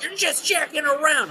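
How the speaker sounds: high, put-on scolding coach voice; mocking coach. Teen retelling her coach's scolding, high mocking voice. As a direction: sneer it like a petty coach. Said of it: "the guy being like".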